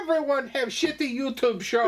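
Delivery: put-on high, hammy Russian accent; goofy Russian accent; bad Russian accent. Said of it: "bad Russian accent".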